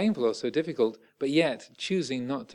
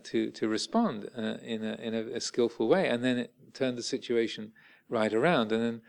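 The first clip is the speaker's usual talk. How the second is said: usual speaking voice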